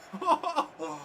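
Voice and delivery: soft breathless laugh of relief; delighted laugh. Real, not acted. Gamer laughing at his winning goal, delighted laugh. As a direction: let out a happy laugh.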